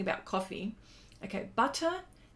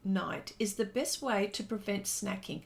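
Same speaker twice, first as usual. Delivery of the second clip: normal talking voice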